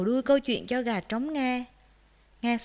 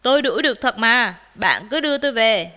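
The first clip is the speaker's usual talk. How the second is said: loud, confident insistence; firm assertion; 9 dB louder, faster than usual. A narrator's reading. Rooster assures doubting rabbit, firm and loud. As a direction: assert it with full conviction. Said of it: "Gà trống khẳng định".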